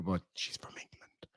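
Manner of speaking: a hushed, breathy whisper; whispered aside. Real, not acted. Whispering to the chat that she's English. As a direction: slip it in under your breath.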